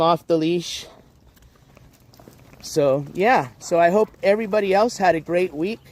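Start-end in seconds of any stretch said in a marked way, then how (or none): none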